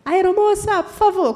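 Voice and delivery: "Aeromoça, por favor" called out loud and high; calling out. Retold or imitated. To the flight attendant, calling out loudly for help. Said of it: "Quando eu falei".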